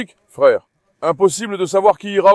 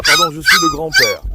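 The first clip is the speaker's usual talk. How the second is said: playful grandpa voice; 5 dB louder than usual. Pretend. Jokingly corrects himself as grandpa.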